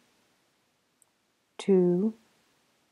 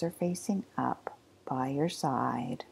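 softer whisper, fading away; 2 semitones lower, 7 dB quieter than usual. Acted. Teacher settling students' hands, fading whisper. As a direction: let the words dissolve into a whisper.

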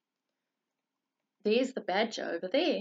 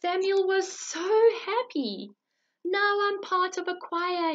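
happy; 8 semitones higher, 4 dB louder than usual. Acted. Happy ending.

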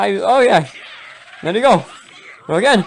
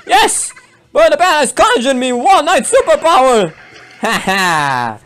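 high, excited outburst; elated, pitched well up; jubilant; 8 semitones higher than usual. Real, not acted. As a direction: crow over a lucky draw. Celebrates a card drawn.

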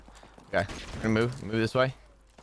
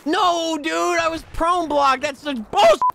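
high, loud, drawn-out protest; outraged yelling; 6 dB louder, slower than usual. Real, not acted. Gamer prone blocked in game, outraged yelling. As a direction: wail it loudly at the injustice.

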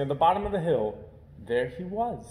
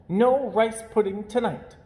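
a firm, higher mother's rebuke; stern mother; 7 semitones higher, 4 dB louder than usual. Acted. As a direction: lay down the law to naughty puppies. Mother punishes puppies.

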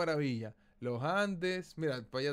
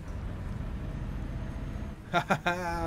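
long, voiceless burst of laughter; breathy laughing fit. Real, not acted. Lost driver amused by the game, breathy laugh. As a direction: crack up with airy laughter.

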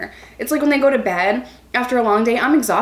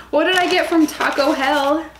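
higher, playful rising question; playful sing-song; 5 semitones higher than usual. Pretend. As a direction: tease the question out, pitched up. Repeats question in new voice.

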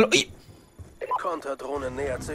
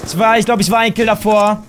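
normal talking voice

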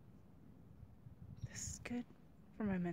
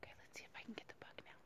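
whispering while framing the deer; soft, barely voiced and quick; hushed whisper; 20 dB quieter, faster than usual. Real, not acted. Hiker whispering to viewers while filming the buck, hushed whisper.

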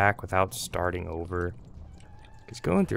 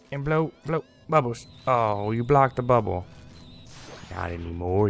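ordinary talk

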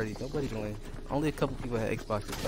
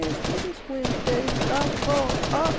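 mock girl scream; 12 semitones higher, slower than usual.

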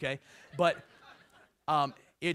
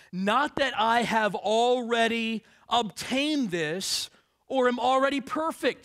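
emphatic Paul quote; 5 semitones higher, 6 dB louder, slower than usual. Acted. Preacher voicing Paul emphatically.

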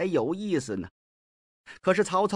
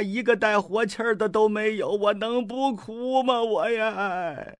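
mock weeping lament; 5 semitones higher than usual. Mi Heng mocks the advisers as corpses, weeping lament. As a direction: wail the insult like a mourner at a grave. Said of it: "哭什么劲儿啊".

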